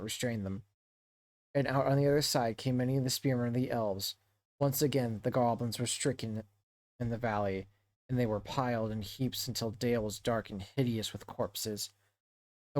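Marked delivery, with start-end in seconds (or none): none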